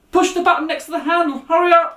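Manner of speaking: high, loud urgent order; trapped man shouting to hurry. Acted. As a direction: yell instructions from inside the car. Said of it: "the man in the car shouted".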